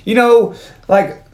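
high, emphatic mock outrage; indignant correction. Zeus setting John straight about his name, indignant correction. Acts Zeus's irritated correction.

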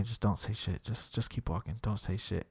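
low, urgent near-whisper; hushed warning. Retold or imitated. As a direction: keep it down, tense and hushed. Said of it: "she's literally, like, telling me".

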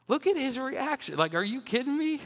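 high-pitched, mocking disbelief; can't believe what you just read; incredulous. Real, not acted. Preacher to congregation about a king's childish sulk, incredulous.

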